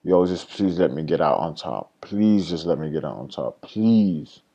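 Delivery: low, hushed plea under the breath; retold begging prayer, said softly and low; pleading prayer. Trader praying to God to get out on top, quietly pleading. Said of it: "literally praying to God".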